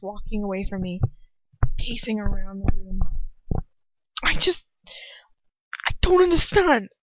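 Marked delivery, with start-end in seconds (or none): 4.2-6.9 high, loud, halting protest; distraught disbelief. A narrator's reading. Ron pacing the room facing Hermione, distraught disbelief. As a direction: protest in upset bewilderment, breaking off. Ron reeling, still in angry argument.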